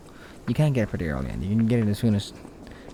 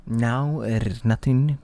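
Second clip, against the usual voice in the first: hammed-up, wandering Irish accent with emphasized R's; bad Irish accent; 4 semitones higher, slower than usual. Player announcing all is done before the game's end, in a bad Irish accent. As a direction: attempt an Irish accent and lose it along the way. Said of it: "First it start Irish, it did not end Irish".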